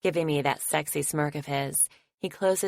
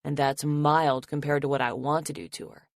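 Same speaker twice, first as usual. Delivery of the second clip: usual speaking voice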